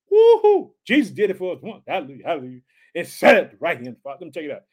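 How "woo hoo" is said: loud, high-pitched woo-hoo; real burst of preacher's elation; excited whoop. Real, not acted. Preacher to congregation after the verse, excited whoop.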